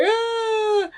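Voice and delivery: high, mock wail of Mary breaking down; mock crying wail. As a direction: imitate a sobbing cry, hammed up. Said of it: "break down and cry".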